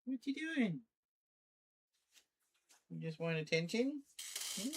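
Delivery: very high, cooing pet voice; baby talk to cat. Acted. Streamer cooing at her meowing cat in high baby talk.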